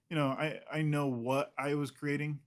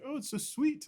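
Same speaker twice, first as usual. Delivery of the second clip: much higher, cooing; gushing agent; 13 semitones higher than usual. Retold or imitated. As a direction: gush over it sweetly. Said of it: "She's just like".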